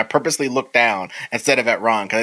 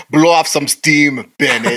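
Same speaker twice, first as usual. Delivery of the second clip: Schwarzenegger one-liner; 4 dB louder, slower than usual. Retold or imitated. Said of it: "to which he says".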